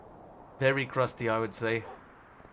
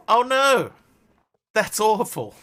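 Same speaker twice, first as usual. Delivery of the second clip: high, loud horrified "oh no"; shocked cry; 9 semitones higher, 10 dB louder, slower than usual. Retold or imitated. Reacting to the guy's underwear answer, a loud horrified cry. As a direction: recoil in loud mock horror. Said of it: "I was like".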